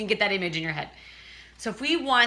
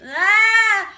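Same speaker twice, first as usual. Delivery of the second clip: high, loud cry of a strained ah; 11 semitones higher, 10 dB louder than usual. Shown as a demonstration. Coach shows a strained, reaching larynx, cried out. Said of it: "reaching with your larynx".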